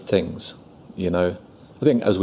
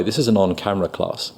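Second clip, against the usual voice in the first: normal talking voice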